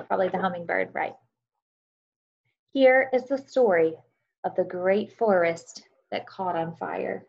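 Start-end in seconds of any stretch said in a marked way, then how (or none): 2.7-7.3 storytelling narration. A narrator's reading. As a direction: tell it like a bedtime story to a class. Reads story aloud to children.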